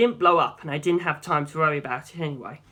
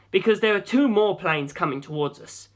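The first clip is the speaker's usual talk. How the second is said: usual speaking voice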